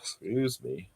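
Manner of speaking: mid-yawn, words stretched. Real, not acted. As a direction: talk through a yawn. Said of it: "I'm yawning".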